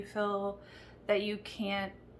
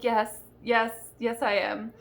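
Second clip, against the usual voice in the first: insistent reply; 6 dB louder than usual. Retold or imitated. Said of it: "And I was like".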